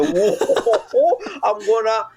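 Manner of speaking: high, laughing mock French voice; hammed-up giggly impression of a French caster. Mocking the French caster hyping himself, high and laughing. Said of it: "I can imagine him in his French home".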